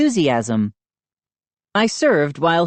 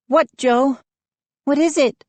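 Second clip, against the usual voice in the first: ordinary delivery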